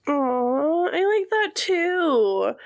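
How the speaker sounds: high, drawn-out fond coo; sweetly touched, stretching the words; touched aww. Real, not acted. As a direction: coo it slowly, lifted in pitch. Touched by cohost's new-friend remark.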